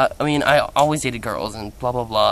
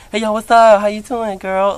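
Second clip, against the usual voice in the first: higher, louder, flamboyant sing-song hello; campy sassy greeting; 9 semitones higher, 8 dB louder than usual. Retold or imitated. Imitating his new greeting to friends, high and campy. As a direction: greet your friends like a fabulous diva.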